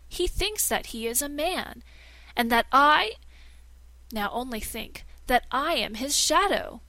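a liar performing grief to the princess; feigned distress. Acted. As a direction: play the wronged master, overacting sorrow.

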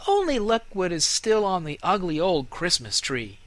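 mock the old tree; jeering child.

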